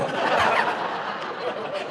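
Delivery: high unvoiced giggle; breathy high laugh; high airy laugh. Real, not acted. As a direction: burst into giggles. Laugh after joke.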